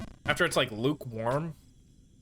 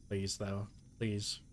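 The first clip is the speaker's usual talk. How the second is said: soft pleading; 6 semitones lower, 11 dB quieter, slower than usual. Real, not acted. Begging the game under breath.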